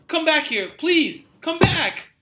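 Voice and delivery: put-on calling after the girl who ran away in fear; pleading call. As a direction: beg her to come back as she runs off. Driver calls after fleeing girl.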